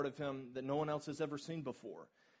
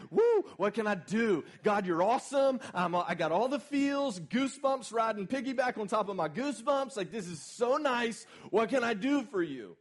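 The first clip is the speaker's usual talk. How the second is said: loud, high, breathless gushing; giddy excitement; 7 semitones higher, 8 dB louder than usual. Retold or imitated. Preacher imitating a pumped-up believer, gushing and loud. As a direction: bubble over like you've got goosebumps. Acts hyped believer after conference.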